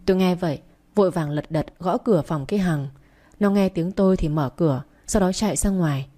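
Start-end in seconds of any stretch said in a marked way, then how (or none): none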